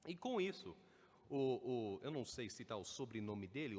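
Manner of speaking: ordinary talk, nothing marked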